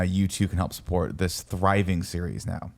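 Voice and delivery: ordinary delivery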